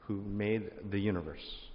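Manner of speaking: ordinary talk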